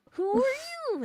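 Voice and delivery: put-on high greeting voice; calling out in character. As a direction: call it out high and cartoonish. Replays Happy's call to the oranges.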